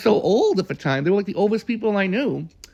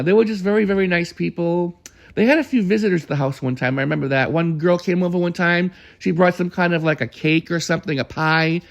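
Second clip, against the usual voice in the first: usual speaking voice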